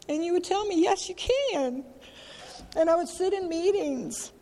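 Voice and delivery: high, tear-choked voice held throughout; tearful. Real, not acted. Sharing her pain with the group, crying. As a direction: keep talking through real tears. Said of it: "it does make me very teary".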